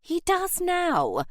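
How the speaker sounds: high, slow, drawn-out comeback; retort. A narrator's reading. Aunt contradicting her nephew, a high retort. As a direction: counter him in a lofty high voice. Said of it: "Lady Gosforth retorted".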